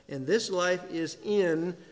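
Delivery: "in" hit loud and drawn out; slow down and land hard on "in"; stress on "in". Shown as a demonstration. Preacher underlining a verse for listeners, big stress on "in". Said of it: "big on the in".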